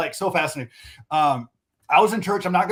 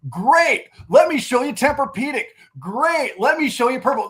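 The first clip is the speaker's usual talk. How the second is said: higher, louder, jumping straight to the sale; eager rushed pitch; 6 semitones higher, 4 dB louder than usual. Retold or imitated. Salesman to customer with a hurt back, overeager jump to the sale. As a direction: gush like an overeager salesman skipping the listening. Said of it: "they don't realize what they just say versus pausing".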